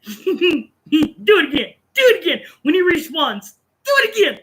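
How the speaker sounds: high-pitched, chanted urging; gleeful egging-on. Acted. Player cheering on a respawn kill, gleeful egging-on. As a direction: urge it on in a lifted, excited voice. Mimicking someone egging on a troll.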